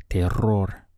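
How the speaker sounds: "Terror" with a strongly trilled R in the middle; rolled R. Shown as a demonstration. Spanish teacher showing learners how to roll the R in "Terror". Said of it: "try to roll your R's in the middle here".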